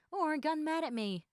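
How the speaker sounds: normal talking voice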